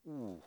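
hushed, low "ooh"; awed "ooh". Acted. Disciples looking at each other after the calm, awed. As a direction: let out a small, soft "ooh" of uneasy awe. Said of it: "then they're really afraid".

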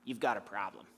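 put-on low, soft odd voice of an old mentor; weird voice of Gene. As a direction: drop low and soft, quiet and unhurried. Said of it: "he had a weird voice".

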